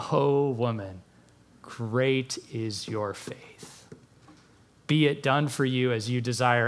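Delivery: hushed, tender wonder at her faith; tender praise. Retold or imitated. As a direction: speak it gently, like a blessing. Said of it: "And then he says".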